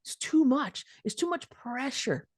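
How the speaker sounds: a tired sigh of a line; deflated. Real, not acted. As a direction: let it sink under the weight. Overwhelmed, trailing quietly.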